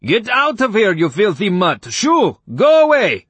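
high, loud indignant shout; furious neighbor yelling in a high voice; high dudgeon. A narrator's reading. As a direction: explode in outraged shooing. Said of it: "explode out of the house in high dudgeon".

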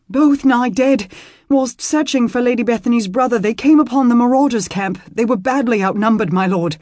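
breathless, loud and high; urgent panting report. Acted. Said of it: "the man said breathlessly".

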